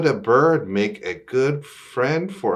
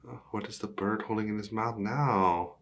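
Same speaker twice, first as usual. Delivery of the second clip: plain reading voice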